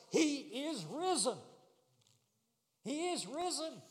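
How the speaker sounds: high, ringing preacher's proclamation; triumphant pulpit cry; Easter proclamation. Shown as a demonstration. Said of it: "This isn't just an Easter proclamation".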